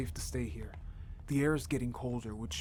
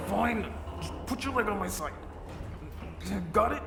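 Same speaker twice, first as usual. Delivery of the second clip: higher and loud, pushed through effort; straining; 6 semitones higher, 4 dB louder than usual. Acted. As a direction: talk while holding the weight. Holding the door, straining.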